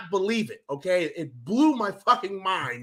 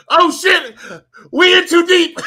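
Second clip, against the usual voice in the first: loud, high comic alarm with laughter; mock panic; 8 semitones higher, 8 dB louder than usual. Fan reliving the game's start, laughing mock panic. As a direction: cry out in hammed-up panic, half laughing.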